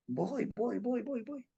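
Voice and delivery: dreamy murmur of someone drifting off; hushed drifting. Retold or imitated. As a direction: float the words away as if going under. Drifting into the vision.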